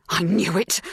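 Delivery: barely suppressed emotion in the voice; trembling with emotion. A narrator's reading. Helen having her suspicion confirmed, trembling. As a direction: hold the feeling in as it escapes. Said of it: "her voice trembling with barely suppressed emotion".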